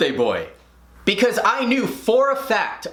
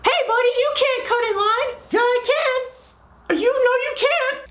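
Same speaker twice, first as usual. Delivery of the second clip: put-on very high squeaky child voices; squabbling kids. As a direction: squeak out a playground spat. Acted child quarrel over line.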